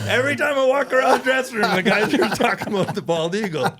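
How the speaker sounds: put-on high, loud, insistent boy; hammed-up innocent exclamation; naive kid outburst. Twelve-year-old explaining locker room talk to dad, naive loud outburst.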